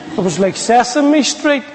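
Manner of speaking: normal talking voice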